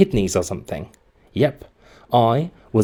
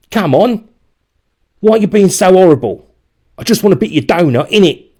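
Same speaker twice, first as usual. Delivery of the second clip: much higher, loud whiny demand; whining bully; 9 semitones higher, 8 dB louder than usual. A narrator's reading. Gamble shaking the boy for donut, loud whiny. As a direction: whine and bully at once.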